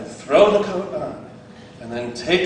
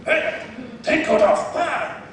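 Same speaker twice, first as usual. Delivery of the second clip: terse broken English orders with sharp high "Ah"; Jackie Chan voice; 9 semitones higher than usual. Retold or imitated. Preacher imitating the master drilling the coat routine. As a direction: drill the student like a strict master. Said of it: "He's like".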